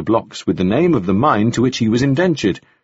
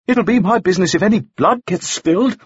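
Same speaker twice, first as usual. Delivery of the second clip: high-pitched firm objection; heated protest; 9 semitones higher than usual. A narrator's reading. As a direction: object urgently, voice raised in pitch. Bystander protests against threatened bloodshed.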